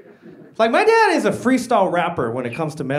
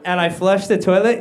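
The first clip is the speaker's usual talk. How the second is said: ordinary talk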